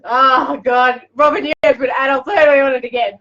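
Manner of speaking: loud, high outburst through laughter; loud laughing protest; helpless amusement. Real, not acted. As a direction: exclaim it while cracking up. Breaking up at a listener's comment.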